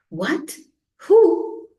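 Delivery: a sharp, high, loud cry of surprise; jolted awake, shriek it; startled shriek. A narrator's reading. Kingfisher woken suddenly, a startled shriek. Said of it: "shrieked Kia".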